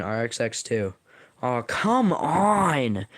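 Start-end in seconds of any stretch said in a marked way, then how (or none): 1.4-3.1 drawn-out, higher-pitched protest; long whining holler at unfairness; exasperated shout. A narrator's reading. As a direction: stretch it out in loud complaint. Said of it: "R X X shouts".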